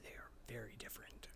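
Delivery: hushed aside, no voice; whispered aside. Real, not acted. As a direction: drop to a whisper.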